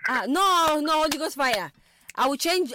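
loud, high-pitched playful protest; mock outrage. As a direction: cry out as if rebuking temptation. Host mock-protests at caller's remark.

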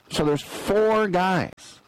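four drawn out high and slow, falling; stress on four. Real, not acted. Host marvels at four refs, stress on four. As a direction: lean hard on four.

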